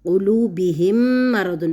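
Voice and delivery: slow, drawn-out tajweed recitation with the merged mim; chanted Quran phrase; Quranic recitation. Shown as a demonstration. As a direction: recite قلوبہم مرض slowly, doubling the مّ. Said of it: "اب میم ساکنہ کے بعد حروف میم،".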